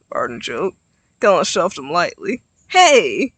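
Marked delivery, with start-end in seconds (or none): 2.7-3.3 loud mock offense; fake insulted. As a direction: pretend to be offended. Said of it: "suddenly fakely insulted".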